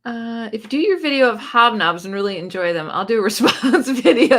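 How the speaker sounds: ordinary delivery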